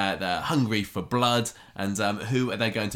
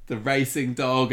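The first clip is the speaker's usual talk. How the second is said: wrestling commentator; 5 semitones higher, 3 dB louder, slower than usual. Acted. Joins the announcer game.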